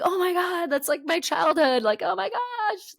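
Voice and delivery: very high excited retold squeal; starstruck gushing. Student meeting childhood star's son, starstruck gushing. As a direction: gush like an awestruck fan. Retells starstruck reaction to classmate.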